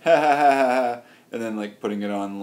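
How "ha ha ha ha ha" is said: loud, hammed-up taunting laugh; imitating a laughing crowd; mock mocking laugh. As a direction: fake a jeering laugh. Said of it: "They'll be like".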